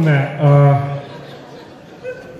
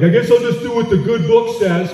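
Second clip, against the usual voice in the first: lightly higher, hammed-up churchgoer voice; a mock pious guy; 7 semitones higher than usual. Guy in the room pretending to be a devout churchgoer. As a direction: play a mock devout man with a laugh. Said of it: "would be like".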